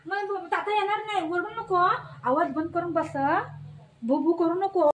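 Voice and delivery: loud stern telling-off to the dog; loud firm dog scolding. Real, not acted. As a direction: order the dog to hush, loudly. Telling barking dog to hush.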